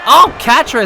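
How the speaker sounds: sudden excited exclamation; excited outburst. Real, not acted. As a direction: call her name with a jolt. Sudden reversal in match.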